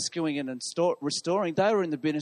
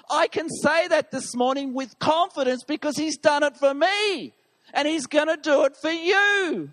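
full-force preaching; 6 semitones higher, 4 dB louder than usual. Acted.

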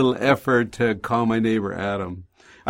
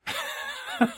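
high breathy laugh; 9 dB quieter than usual. Real, not acted. Laughs at the punchline.

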